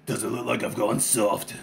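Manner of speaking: low, slow, soft yakuza voice; Tatsu's deep voice. Retold or imitated. Ex-boss to his old henchman, low and slow. Tatsu after throwing Saki out.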